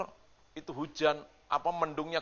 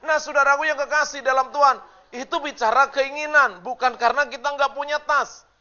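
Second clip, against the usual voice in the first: loud, high, ringing sermon voice; full-force preaching; 14 semitones higher, 14 dB louder than usual. Acted. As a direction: preach it out to the whole church, slowing on the key lines. Khotbah dengan suara penuh. (khotbah berapi-api dengan suara lantang)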